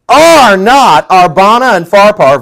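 slow, loud boastful question; proud Naaman. Retold or imitated. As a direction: boast of the home rivers. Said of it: "verse twelve".

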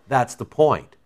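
usual speaking voice

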